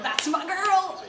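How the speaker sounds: loud high slow proud shout; proud cheer. Retold or imitated. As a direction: cheer her on loudly. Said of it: "Reggie's like".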